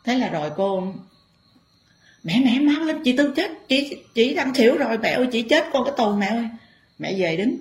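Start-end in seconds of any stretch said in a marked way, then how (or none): 2.2-6.5 frantic call for help. Retold or imitated. Relative calling the Mother spirit as chị Tư collapses, high and panicked. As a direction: cry out that she is dying.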